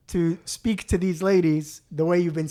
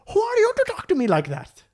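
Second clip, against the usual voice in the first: outraged customer. Retold or imitated. Customer turning around.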